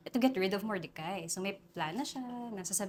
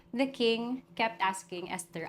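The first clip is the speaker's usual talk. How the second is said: normal talking voice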